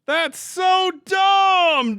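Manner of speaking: very high, slow, drawn-out shout; real outrage wailed at the ceiling; exasperated yell. Real, not acted. Streamer yells to chat about the crash, very high and drawn out. Disconnected from Steam mid-battle.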